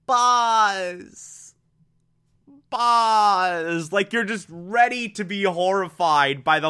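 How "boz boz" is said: higher, panicked no, no, Boz, Boz; imitating horror. Viewer reliving the scene, mock horrified cry for Boz. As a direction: voice rising in mock dread over Boz. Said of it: "really terrifying moment where you think".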